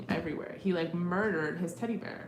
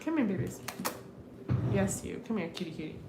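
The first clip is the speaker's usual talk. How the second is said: coaxing pet talk; 2 semitones higher, 6 dB quieter, faster than usual. Real, not acted. Coaxing the dog over cords.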